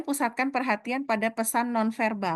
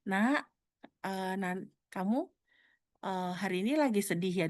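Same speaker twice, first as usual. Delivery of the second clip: gentle caring question; 5 dB quieter than usual. Shown as a demonstration. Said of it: "itu kan beda gitu ya".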